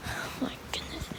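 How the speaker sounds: barely voiced disbelief; exasperated whisper. Real, not acted. Locked out and talking to self, exasperated whisper.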